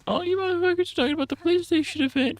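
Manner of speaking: hammed-up high-pitched yell at chat; mock yell. Yelling at chat to leave.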